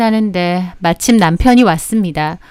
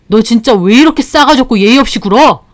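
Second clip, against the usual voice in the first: angry brother; 4 dB louder than usual. Acted. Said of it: "그러더니 화가 나서".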